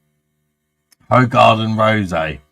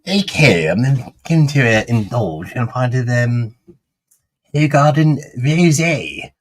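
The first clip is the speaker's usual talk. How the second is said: posh voice; 3 semitones higher than usual. Pretend. Doing the requested posh review of a rosé for friends. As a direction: play the snooty wine connoisseur. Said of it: "Sounds rather posh".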